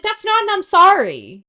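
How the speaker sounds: high, slow scolding; retold scolding. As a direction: scold him sharply. Scolding landscaper.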